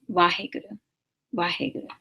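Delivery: "Waheguru" opening halfway between V and W; blended V and W. Shown as a demonstration. Teacher demonstrating the combined letter, V and W blended. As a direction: merge the two sounds at the start of "Waheguru". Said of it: "we have combined the two of them".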